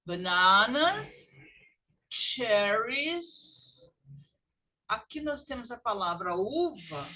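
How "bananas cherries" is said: bananas, slow and loud; slow model word. Shown as a demonstration. Teacher reading the word list, slow and loud. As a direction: stretch bananas out for the class. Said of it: "So, apples".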